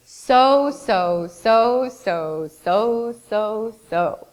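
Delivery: singsong drone, alternating up and down; tedious repetition. Retold or imitated. Teacher mimicking students' overused word, singsong droning. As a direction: chant the word like an endless chain. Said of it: "My other favorite".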